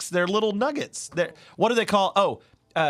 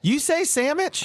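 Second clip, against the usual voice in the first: high, drawn-out disbelieving question; incredulous; 11 semitones higher, slower than usual. Real, not acted. Co-host questioning his friend's word, incredulous. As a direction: challenge it in high-pitched disbelief. Co-host challenges the odd pronunciation.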